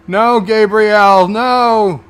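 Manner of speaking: loud, slow, high "no!"; real distressed cry at the screen; pleading shout. Real, not acted. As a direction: yell at the TV, begging him to stop.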